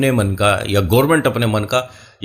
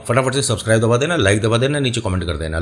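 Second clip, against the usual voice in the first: rushed subscribe plea; faster than usual. Real, not acted. Quick subscribe plea aside.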